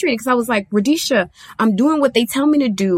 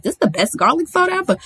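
imitating herself; 7 semitones higher, faster than usual. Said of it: "'cause I was like".

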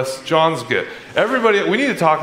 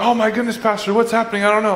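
higher, mock-panicked church member; panicked congregant; 7 semitones higher, faster than usual. Church member rushing up to the pastor in mock panic. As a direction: imitate a worried person pleading with the pastor. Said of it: "when people come up to me".

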